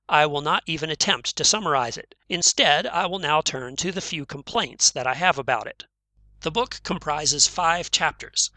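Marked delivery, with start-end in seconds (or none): none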